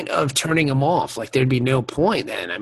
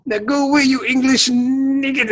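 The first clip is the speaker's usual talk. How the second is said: put-on French taunter accent; 10 semitones higher than usual. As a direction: sneer it like a French guard on the wall. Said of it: "he pronounces the K".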